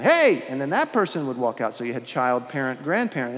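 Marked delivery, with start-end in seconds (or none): 0.0-0.4 high, loud cheerful "Hey!"; answering call. Retold or imitated. Parent answers the child from the next room, loud call. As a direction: call back brightly from offstage. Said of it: "you hear this voice in the background".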